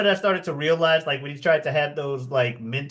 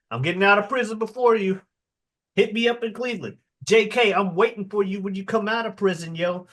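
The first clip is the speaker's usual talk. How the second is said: higher-pitched, quick hustling pitch; imitated eager manager; 6 semitones higher than usual. Manager pitching himself to a prisoner, eager and higher. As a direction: play the would-be manager, pitched up.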